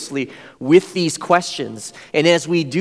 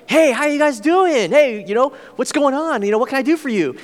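much higher, bright and eager greeting; super friendly host; 8 semitones higher than usual. Retold or imitated. Host greets the visiting students at his door, much higher and eager. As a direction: play the overly friendly neighbour. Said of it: "he was, like, super friendly".

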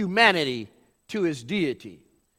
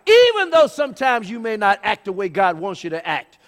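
high, forceful preaching voice; 7 semitones higher, 4 dB louder than usual. Real, not acted. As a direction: drive the point home to the congregation.

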